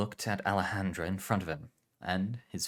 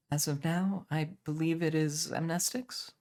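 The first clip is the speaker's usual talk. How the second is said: much higher, stiff and formal; stiff young woman; 9 semitones higher than usual. Acted. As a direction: apologize stiffly, high. Aurelia answers.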